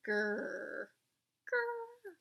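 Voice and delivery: a playful growl on Grr; mock growl. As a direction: growl the name like a grumpy animal. Naming the mean neighbor.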